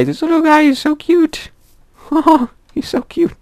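high squeaky cooing at a bunny; squealing over a cute bunny. Real, not acted. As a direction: coo at it like a tiny pet.

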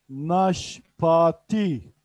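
Naşpati drawn out very slowly, each syllable clear; slow model word. Shown as a demonstration. Teacher to online Urdu class: Naşpati drawn out very slowly. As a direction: stretch Naşpati slowly for learners. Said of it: "repeat slow model".

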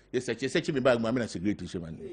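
ordinary talk

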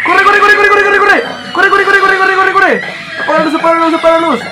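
high, loud, rapid-fire repeats; panicked shouting. Real, not acted. Player fleeing and calling for lights off, panicked high shouting. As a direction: rattle the words out screaming in panic.

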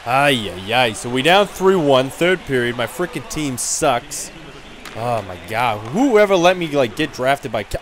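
ordinary talk

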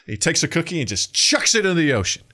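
normal talking voice

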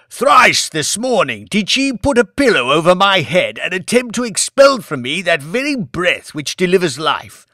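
loud, declaiming curse; raging tragedian. A narrator's reading. Old lord denounces his wife, loud and Shakespearean. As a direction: thunder it like King Lear.

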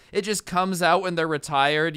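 ordinary talk, nothing marked

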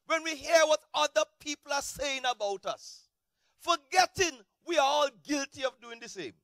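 full-force preaching. Acted.

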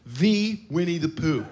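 The drawn out slow, stressed hard as in the one and only. Acted. To the crowd, The stretched out slow and grand.

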